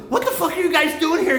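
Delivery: high, loud retold challenge; mock incredulous yell. Ambulance guy challenging bikers out his window, high mock yell. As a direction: imitate a stranger yelling, pitch shooting up.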